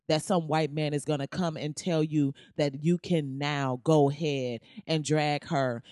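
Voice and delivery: ordinary talk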